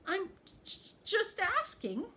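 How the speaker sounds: frightened ogre answers the goat, high stuttering voice. Acted. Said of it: "stuttered Little Ogre".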